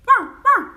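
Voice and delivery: high, loud barking wow wow; Chinese dog bark. Shown as a demonstration. Showing viewers the Chinese dog sound, high loud bark. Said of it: "we say".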